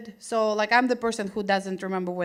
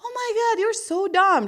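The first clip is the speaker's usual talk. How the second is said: teasing roommate; 10 semitones higher than usual. Retold or imitated. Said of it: "she goes".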